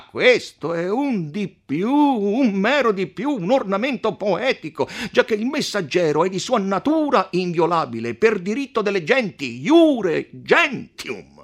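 insistent, emphatic protest; shouting in argument. A narrator's reading. Podestà defending the messenger against the count, shouting. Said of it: "replicava non meno urlando".

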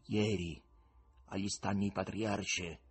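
slow, dropping, each word set apart; authoritative, words detached. Acted. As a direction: deliver it like a verdict, pause by pause. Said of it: "in tono autorevole e staccando le parole".

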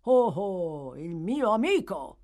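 loud hearty holler; boisterous shout in a put-on voice; shouted greeting. A narrator's reading. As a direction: hail a friend from another room. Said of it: "urlò la stessa voce".

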